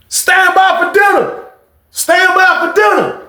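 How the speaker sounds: high, slow drawn-out guard call. Retold or imitated. Guard calling out to cells.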